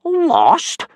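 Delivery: very high, loud scoffing echo; snorting scorn. Acted. Teacher snorting back at a worried boy. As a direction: snort it in disbelief. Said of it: "snorted Gobber".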